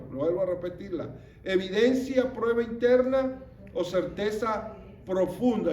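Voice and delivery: usual speaking voice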